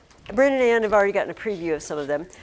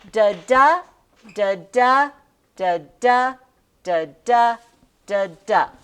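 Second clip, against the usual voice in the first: five evenly spaced "da, da" pairs, each second "da" louder; perfect iambic beat; 6 dB louder than usual. Shown as a demonstration. Teacher demonstrating ten syllables, iambic stress on every second "da". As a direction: chant weak-STRONG on "da, da", short gap between pairs. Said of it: "Perfect would be this".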